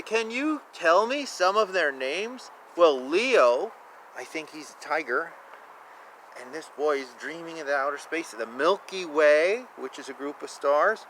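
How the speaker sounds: ordinary delivery, nothing marked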